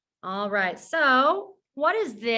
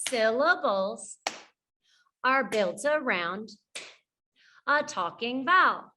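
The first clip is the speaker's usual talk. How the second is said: call-and-repeat phrasing. Shown as a demonstration. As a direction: pause after "Syllables" and "are built around". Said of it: "Good, try it again".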